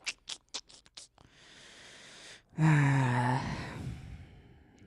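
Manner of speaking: long, loud exhaled sigh. Real, not acted. Waiting for the next radar scan, loud weary sigh. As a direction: let out a big drawn-out sigh.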